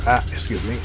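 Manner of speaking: rough, failing voice; hoarse voice. Real, not acted. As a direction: voice giving out. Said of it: "My voice is really going on me".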